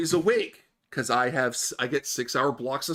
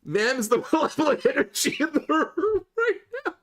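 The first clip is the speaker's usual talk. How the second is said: high squeal of laughter through the words; helpless laughter; 11 semitones higher than usual. Real, not acted. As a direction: try to ask it while losing it laughing. Joke question while cracking up.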